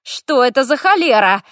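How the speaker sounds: loud startled yell. A narrator's reading. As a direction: shout it out in alarm. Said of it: "заорала я".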